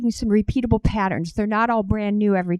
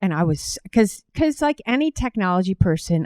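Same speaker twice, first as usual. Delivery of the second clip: normal talking voice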